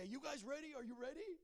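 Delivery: high-pitched call down to the guys; imitated diver's call. Buddy on the high board to waiting players, high imitated call. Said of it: "He'd say".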